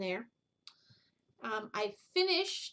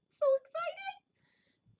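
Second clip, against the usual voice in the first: excited; much slower than usual. Real, not acted. Excited reaction to getting mail.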